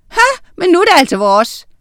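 high, quick, loud comeback; cheeky quarrelling child; defiant retort. A narrator's reading. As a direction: throw it back triumphantly. Children quarrel over classroom.

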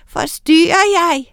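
high, sweet put-on question; sugary sweet. A narrator's reading. Witch asking if she intrudes, sugary sweet. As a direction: ask with fake sweetness. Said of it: "sagde hun sukkersødt".

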